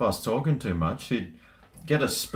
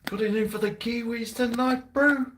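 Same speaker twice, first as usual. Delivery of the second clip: rugby commentator; 12 semitones higher than usual. Pretend. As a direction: call the match like TV commentary. Repeats commentator line.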